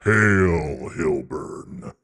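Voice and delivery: drawn-out dragon greeting; ponderous monster voice, falling at the end. Acted. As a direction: hail them slowly as a great dragon. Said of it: "which one's my dragon voice".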